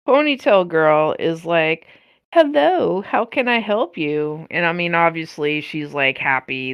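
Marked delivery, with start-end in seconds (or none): 2.3-4.5 customer service greeting. Retold or imitated. Ponytail girl greets the customer.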